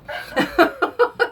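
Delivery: short high laugh; laughing at herself. Real, not acted. Laughs at own hoarse voice.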